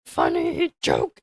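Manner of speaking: slow, throat-straining creature croak; low, broken croak, dragged out; failed attempt at a wise little alien; Yoda. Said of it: "I can't do a Yoda voice anymore".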